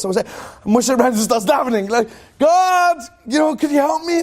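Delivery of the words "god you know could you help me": high-pitched call for help; acted plea. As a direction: call up to God, imitating a pleader. Retold plea to God when trapped.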